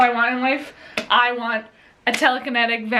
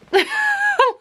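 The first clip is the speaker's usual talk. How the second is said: high laugh; 4 dB louder than usual. Real, not acted. Laughing at a plot hole.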